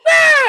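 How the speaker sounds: sudden high, loud startled scream; short shrieked "Ah!" at full volume; imitated scream. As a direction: yelp like you just got a shock. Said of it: "the best reaction to anything when you hear the".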